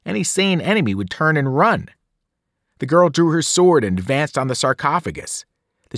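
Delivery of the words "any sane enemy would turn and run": ordinary delivery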